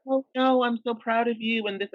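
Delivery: mock coworker gushing insincerely. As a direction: imitate a phony well-wisher, lightly hammed. Mocking coworker's fake praise.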